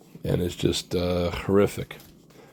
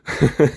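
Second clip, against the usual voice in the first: excited laugh; 8 semitones higher, 6 dB louder than usual. Real, not acted.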